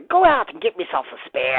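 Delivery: light mock pirate accent on a spear wish. Builder doing a pirate accent over a spear wish, lightly. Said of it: "in a, uh, pirate accent".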